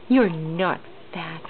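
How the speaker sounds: ordinary delivery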